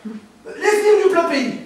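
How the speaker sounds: put-on slow, laughing Belgian accent; hammed-up Belgian. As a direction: savour the fake company name. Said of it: "producteur belge".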